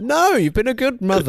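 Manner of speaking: high, insistent reassurance; emphatic mock protest. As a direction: protest it with comic urgency. Retold reassurance of upset mum.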